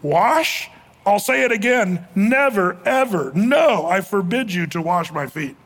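stubborn, emphatic refusal; emphatic insistence. Shown as a demonstration. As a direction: pound out never, ever, no, slowing down. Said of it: "the sentence actually would look like this".

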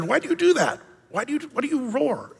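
squeaky, very high little mouse; a small mouse asking the lion, very high and soft. Acted. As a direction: ask it like a tiny curious mouse.

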